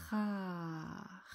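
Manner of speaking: "Ra" slowly stretched, held r into long a; syllabe étirée. Shown as a demonstration. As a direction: draw out "Ra" from a throaty r through a long a. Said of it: "pour accrocher le A". (« Ra » étiré, r tenu puis a long)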